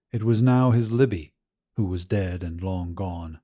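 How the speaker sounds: ordinary delivery, nothing marked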